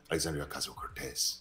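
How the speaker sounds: exaggerated Spanish accent on the surname; mock Spanish accent. Mocking a congresswoman saying her own name, Spanish accent. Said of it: "she goes into a Spanish accent".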